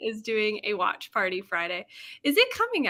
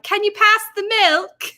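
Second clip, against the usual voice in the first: high, loud mock British accent; coworker's playful fake British accent; silly British accent; 8 semitones higher, 6 dB louder than usual. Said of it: "kind of British accent".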